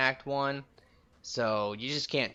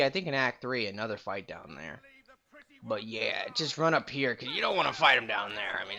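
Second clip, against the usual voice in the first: usual speaking voice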